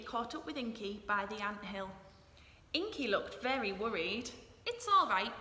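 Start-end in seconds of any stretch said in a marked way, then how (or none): 4.6-5.3 higher, louder bee voice; lightly buzzed Bee. A narrator's reading. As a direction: Bee reassuring Inky, buzzing a little. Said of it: "buzzed Bee".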